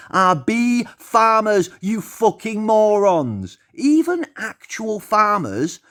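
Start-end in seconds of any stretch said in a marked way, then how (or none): none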